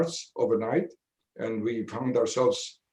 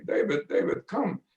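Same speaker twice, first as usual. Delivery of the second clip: higher-pitched mock calling out to a friend; retold excited yelling; 7 semitones higher than usual. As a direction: call him out of the outhouse, half-yelling with joy. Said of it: "they were yelling at me".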